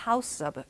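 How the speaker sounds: "house of" run together as hau-sob; linked pronunciation. Shown as a demonstration. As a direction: join the final s of "house" onto "of". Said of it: "요런 식으로 발음이 됩니다".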